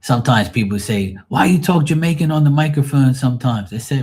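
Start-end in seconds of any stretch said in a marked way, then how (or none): 1.3-3.1 raised, louder voice of a questioner; imitating questioners. Listeners challenging the DJ on his talk, imitated in a high, loud voice. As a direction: mimic people asking, pitched up and loud.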